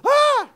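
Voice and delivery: high, loud yelp of alarm; alarmed cry. Shown as a demonstration. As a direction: act out a startled outcry. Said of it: "Don't be alarmed".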